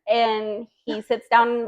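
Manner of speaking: ordinary delivery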